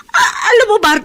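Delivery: mother sobs out her hurt to Jobert; crying. Acted. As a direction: break down while begging her son. Mother pleads with her son.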